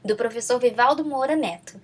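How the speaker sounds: ordinary delivery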